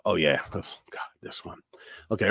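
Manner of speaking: ordinary talk, nothing marked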